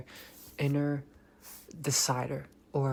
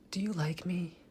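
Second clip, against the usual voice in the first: breathy whispered higher mock beta plea; mock beta guy; 5 semitones higher, 4 dB quieter than usual. Mocking a beta guy asking a woman, timid whisper. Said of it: "your voice sounds beta".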